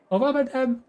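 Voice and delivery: put-on high, sweet girly farewell; lightly voiced young girl. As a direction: play the schoolgirl politely leaving. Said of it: "une voix de meuf".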